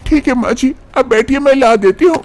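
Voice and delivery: put-on high-pitched young wife's voice, complaining; young wife Manisha. Manisha obeys mother-in-law.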